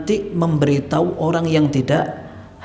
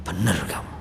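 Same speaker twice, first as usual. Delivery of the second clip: much lower, softer, conceding; humbled admission; 11 semitones lower, 7 dB quieter than usual. Retold or imitated. Rebuked man admitting the scholar is right, humbled and soft. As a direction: quietly give in, chastened. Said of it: "dia bilang".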